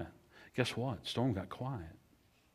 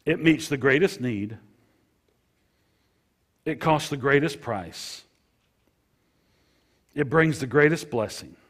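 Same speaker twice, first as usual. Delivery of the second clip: louder and higher, each greatest punched; emphatic preaching; 4 semitones higher, 7 dB louder than usual. Shown as a demonstration. Pastor proclaiming forgiveness to his flock, emphatic and loud. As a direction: proclaim it to the back row. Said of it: "Let me share that with you again now".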